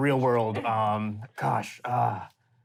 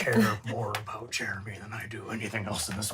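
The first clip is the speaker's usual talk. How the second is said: hushed, breathy confession; mock earnest whisper; 4 semitones lower, 6 dB quieter than usual. Player confessing love for the pet. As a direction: whisper it like a solemn vow.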